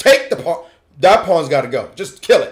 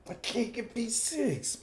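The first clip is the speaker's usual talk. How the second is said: very soft, slow grumble; acted muted frustration; mock annoyance; 19 dB quieter than usual. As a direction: grumble it quietly, drawn out.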